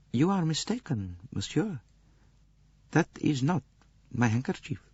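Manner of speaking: soft, high, polite denial; cool Countess. A narrator's reading. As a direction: deny it coolly, keeping her poise. Countess denying to Poirot.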